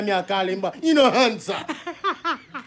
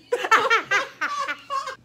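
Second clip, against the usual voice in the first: high laugh; 13 semitones higher than usual. Real, not acted. Laughing at his own story.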